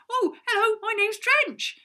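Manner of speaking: high, loud, bubbly hello; happy greeting. Acted. Drench happily introducing herself, high and loud. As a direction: beam through the introduction. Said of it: "such a happy person".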